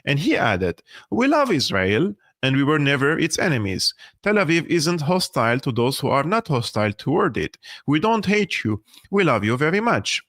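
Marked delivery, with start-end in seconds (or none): none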